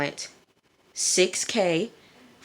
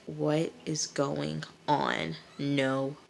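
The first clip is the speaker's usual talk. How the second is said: slow, soft imitated disbelief; stunned disbelief; 7 dB quieter than usual. Jay watching the panic, quietly stunned disbelief. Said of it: "Jay is looking like".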